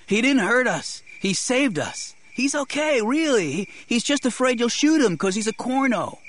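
put-on high-pitched boy's voice; boy calling out. A narrator's reading. Boy calls out to defend his rescuer, high and urgent. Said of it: "Terrell called".

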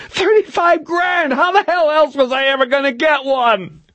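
high, hysterical screamed rant; frantic, desperate high-pitched tirade; Milosevic screaming. A narrator's reading. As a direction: keep screeching self-justification at a very high pitch. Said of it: "Reacher listened to the screaming voice".

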